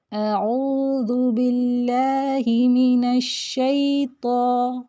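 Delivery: اعوذ باللہ من الشیطان drawn out; slow model recitation. Shown as a demonstration. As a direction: recite the whole phrase in slow tajweed. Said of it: "یہیں تک دیکھ لیتے ہیں". (اعوذ باللہ من الشیطان کی آہستہ تلاوت)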